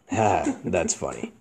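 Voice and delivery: low, soft, unamused acknowledgement; monotone. Shown as a demonstration. Demonstrating his muted emotions, monotone. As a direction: give a token laugh in a dull low voice. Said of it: "I talk like that".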